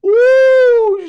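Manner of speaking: high loud whoop of laughter; real laughing whoop. Real, not acted. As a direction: let out a high howling whoop. Howling with laughter.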